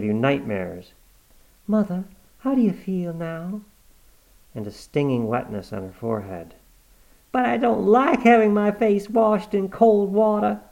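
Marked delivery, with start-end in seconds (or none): 1.7-3.6 higher, gentle worried daughter; anxious daughter. Acted. Cornelia asking her dying mother how she feels, worried. 7.3-10.6 put-on higher, louder peevish old mother; cranky old woman. Granny complaining about her face being washed, peevish.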